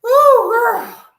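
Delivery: loud, very high whoop; heart-pounding shock. Real, not acted. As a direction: let out a big shaken "whew". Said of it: "my heart just started beating".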